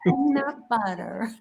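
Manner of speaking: low, soft, slow try at American PEANUT BUTTER; hesitant American accent imitation; attempted American accent. Shown as a demonstration. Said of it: "Repeat after me".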